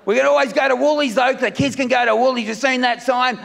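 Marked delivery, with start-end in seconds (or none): none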